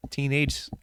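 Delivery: ordinary talk, nothing marked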